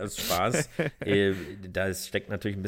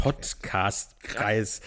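very slow, drawn-out words; slow drawl; much slower than usual. Real, not acted. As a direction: stretch each syllable out slowly. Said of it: "Hast du getrunken oder was ist los?".

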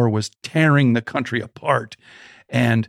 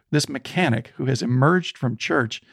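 usual speaking voice

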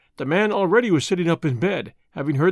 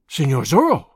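gasping; 3 semitones lower, 4 dB louder than usual. A narrator's reading. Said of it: "he gasped".

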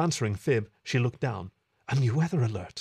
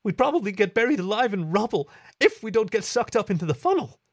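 high, loud frightened rant; rising panic; 10 semitones higher, 4 dB louder than usual. A narrator's reading. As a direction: spiral into worst-case panic. Panicking about the tornado.

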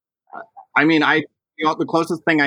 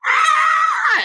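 a high, squealing cry of joy; excited shriek; 3 dB louder than usual. Retold or imitated. Retelling a mother running downstairs, high joyful squeal. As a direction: squeal it out like she just burst down the stairs. Retold ecstatic moment on stairs.

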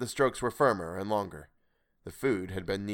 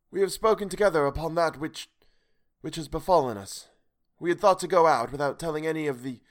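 higher, grave and sorrowful stranger; higher, solemn voice out of the night; the strange voice; 6 semitones higher, 3 dB louder than usual. Acted.

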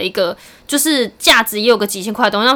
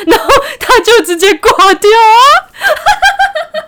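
high, loud and slow, words stretched by laughter; triumphant laughing; 11 semitones higher, 5 dB louder, much slower than usual. Real, not acted. Laughing at scammer hanging up.

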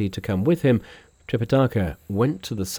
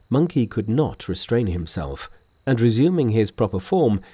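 ordinary delivery, nothing marked

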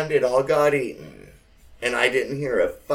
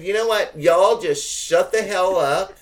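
higher, louder snap at the family; fed-up outburst; 6 semitones higher, 6 dB louder than usual. Retold or imitated. Telling the squabbling relatives to shut up, fed up. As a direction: tell them to shut up, fed up. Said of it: "So finally I said".

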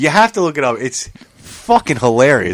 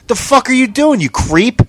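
mock angry; 8 semitones higher than usual. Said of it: "all pissed off".

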